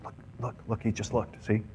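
hushed, urgent teasing; sneaky soft nudging; conspiratorial whisper. Acted. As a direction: whisper it so only your companion hears. Secretly pointing out a guy.

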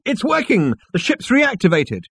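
high, excited announcement; excited shouting. A narrator's reading. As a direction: call out the good news. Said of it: "he shouted".

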